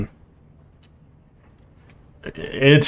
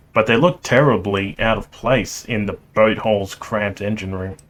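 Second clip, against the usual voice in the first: usual speaking voice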